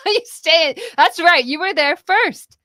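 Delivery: loud, high cheering-on; emphatic backing. Retold or imitated. Mother backing her daughter who got there first, emphatic encouragement. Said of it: "And I was like".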